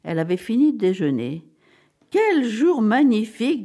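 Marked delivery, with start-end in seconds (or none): 2.1-3.6 high, slow, gushing exclamation; radiant delight. A narrator's reading. Secretary greeting Mrs Bixby in triumph, radiant. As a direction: draw it out through a beaming smile. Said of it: "dans un sourire éclatant".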